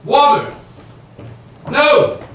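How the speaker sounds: loud shouts for water; calling out. Acted. Creditor calling to the servant for water, loud. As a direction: bark the order at the servant.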